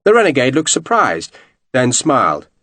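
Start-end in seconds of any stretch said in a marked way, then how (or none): none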